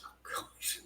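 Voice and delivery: barely audible muttered aside; whispered dismay. Retold or imitated. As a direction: whisper it under your breath, dismayed. Reluctant reaction to coach's demand.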